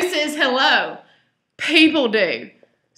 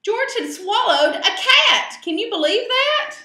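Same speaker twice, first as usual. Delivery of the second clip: laughing amazement; 10 semitones higher, 4 dB louder than usual. A narrator's reading. Storyteller marvels with laugh.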